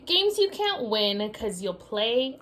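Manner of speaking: ordinary talk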